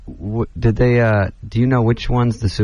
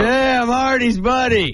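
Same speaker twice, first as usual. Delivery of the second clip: higher, slow mock buddy voice; imitating a wheezy hanger-on; 10 semitones higher, slower than usual. Comic telling a bar story, imitating the fan higher and slow. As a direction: ham up the pushy guy who drops a friend's name. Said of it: "He goes".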